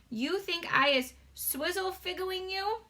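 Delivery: high, slow giant voice, word said cleanly; a gentle giant getting it right; BFG voice, retried. Acted. Giant asking Sophie, clean second take. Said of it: "Let me try again".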